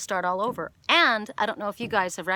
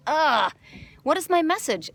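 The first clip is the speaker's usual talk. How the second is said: exasperated asking; 8 semitones higher, 4 dB louder than usual. Retold or imitated. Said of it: "And I thought".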